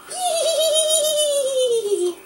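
high delighted laugh. Real, not acted. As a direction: laugh brightly. Laughing with baby.

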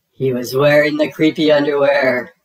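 loud dramatic shock; shocked reveal. A narrator's reading. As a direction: blurt the twist out loud.

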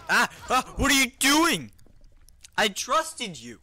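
high, loud cry of betrayal; mock betrayed outrage. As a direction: shriek in hammed-up outrage at a traitor. Acts betrayed by attacker.

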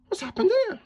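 very high, squeaky astonishment; tiny high cartoon gasp of a question; shocked cucumber character. A narrator's reading. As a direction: squeak it out in stunned disbelief. Cucumber freezes at the scene.

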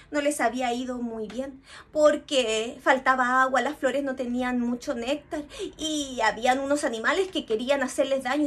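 plain reading voice

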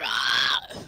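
loud growl shout. Real, not acted. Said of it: "bro just growled".

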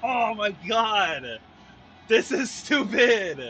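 slow, drawn-out despairing groan; stretched, anguished exasperation; mad, exasperated wail. Real, not acted. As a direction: wail out your frustration slowly. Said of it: "I'm so mad right now".

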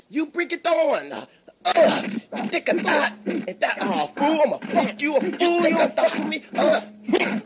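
high and loud, laughing threats; angry shout. Acted. Owner yelling threats back at the customer, high laughing shout. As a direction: yell back with crazed glee.

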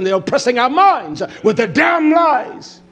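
shouted indictment from the pulpit; angry preaching shout. Acted. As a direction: hurl the accusation at the crowd.

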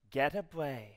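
ordinary talk

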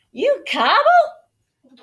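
high, loud, slow question; puzzled farmhand. A narrator's reading. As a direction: draw it out in amazed confusion. Hank puzzled by the answer.

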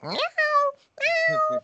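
high squeaky meowing like a cat. Retold or imitated. Meowing at the family cat, high squeaky cat voice. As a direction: mew high like a kitten. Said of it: "I just start making animal noises".

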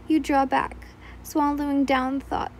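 plain reading voice

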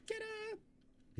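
high, squeaky ta-da. Pretend. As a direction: sing out the reveal. Announcing the code drop.

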